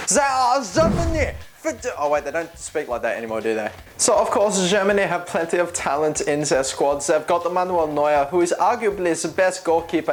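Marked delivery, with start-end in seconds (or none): 0.1-1.3 high, slow, hammed-up German accent; over-the-top mock German announcer. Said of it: "I need to put on the accent". 4.0-10.1 higher-pitched mock German accent; put-on comic German voice. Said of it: "I can't talk like this".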